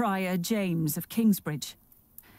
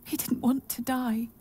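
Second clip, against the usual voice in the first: ordinary delivery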